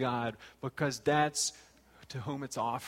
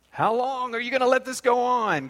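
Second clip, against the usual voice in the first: high, loud anguished cry; Habakkuk's complaint; 10 semitones higher, 10 dB louder than usual. Retold or imitated. Habakkuk crying out to God, a loud anguished complaint. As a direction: shout the question up to heaven.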